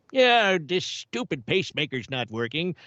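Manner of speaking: put-on old man voice; old man character. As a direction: complain as a cartoon old codger. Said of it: "a young version of my old man self".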